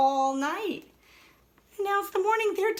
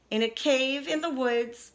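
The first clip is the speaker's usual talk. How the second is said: usual speaking voice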